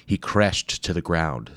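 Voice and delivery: usual speaking voice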